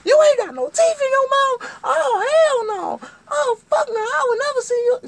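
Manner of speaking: shrieking, very high outrage; piercing hammed-up tirade; all hell breaking loose. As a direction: scream in mock horror and fury. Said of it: "all hell break loose then".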